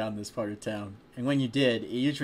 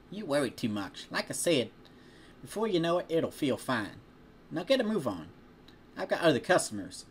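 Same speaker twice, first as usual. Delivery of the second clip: put-on friendly farm girl; 5 semitones higher than usual. Farm girl reassuring a friend about a healing tattoo, higher friendly voice. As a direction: tell him off, then comfort. Applejack speaking in story.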